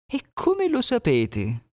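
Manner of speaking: sly fox. Acted. Fox probing the animals, sly. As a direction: ask with false curiosity.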